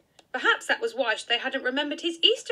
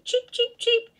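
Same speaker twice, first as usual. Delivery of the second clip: high, tiny peeping chick voice; chick cheeping; 13 semitones higher, 5 dB louder than usual. Acted. Three little yellow chicks cheeping, high peeps. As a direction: peep it out like a baby chick. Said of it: "chicks running about saying".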